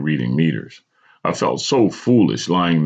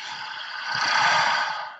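long forceful exhale; controlled breath; 6 dB quieter than usual. A narrator's reading. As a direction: breathe out hard to regain control. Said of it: "I breathed to regain control".

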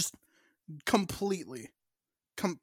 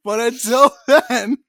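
laughing; 3 semitones higher, 6 dB louder than usual. Real, not acted. Laughing retort.

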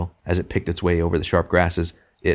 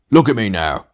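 louder, higher and self-important; blustering demand; 4 semitones higher, 6 dB louder than usual. A narrator's reading. Ghost slapping its chest at the other, blustering. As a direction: demand attention with a puffed-up boast.

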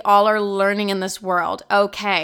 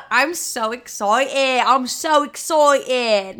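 slow, drawn-out show of excitement; hammed-up excitement; 3 semitones higher, 3 dB louder, much slower than usual. Vlogger celebrating a follower milestone, hammed-up excitement. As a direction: stretch every word in playful over-excitement.

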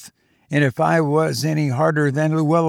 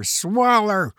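hollering; 7 semitones higher, much slower than usual. A narrator's reading. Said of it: "I fairly hollered at her".

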